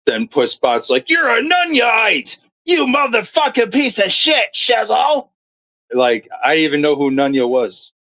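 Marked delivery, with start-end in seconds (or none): 1.1-5.3 furious outburst. Retold or imitated. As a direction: mimic a raging streamer losing it. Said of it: "And Pussbot was so mad".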